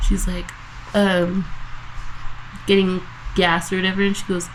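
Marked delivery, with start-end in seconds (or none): none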